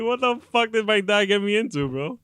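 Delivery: high-pitched mock bewilderment, dropping at the end; imitated dismay. Daughter blaming dad for the x-ray, mock dismay. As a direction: lightly play a kid in over her head. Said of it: "She's like".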